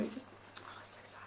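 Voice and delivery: sad breathy whisper. Real, not acted. Feeling sorry for Maxi the suitor: sad whisper. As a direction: whisper it, heartbroken for him. Pitying Maxi, voice drops away.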